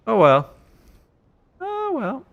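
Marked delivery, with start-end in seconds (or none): 1.6-2.2 much higher squeaky falsetto. Acted. Streamer echoing himself in a joke voice, high squeak. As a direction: squeak it out like a cartoon. Repeats phrase in two voices.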